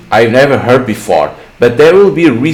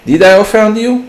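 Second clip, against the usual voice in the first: high-pitched acted query; put-on higher voice, challenging; pointed question; 10 semitones higher than usual. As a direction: question climbing steeply in pitch. Stranger questions the student.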